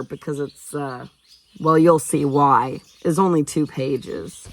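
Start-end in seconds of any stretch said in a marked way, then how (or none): none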